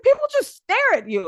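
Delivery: high, loud mock-incredulous friend; imitating Kelly. Friend Kelly asks how she copes, high and incredulous. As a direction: squeak it out like a shocked friend. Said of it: "She was like".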